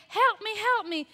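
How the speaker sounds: loud, high pleading cries; imitated screams. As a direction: cry out for help like the lady next door. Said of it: "This lady's saying".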